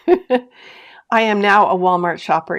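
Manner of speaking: slow, laughing through the words; giggling at her own embarrassment, slowly. Real, not acted. Confessing to viewers she shops at Walmart, laughing. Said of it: "I'm laughing so hard".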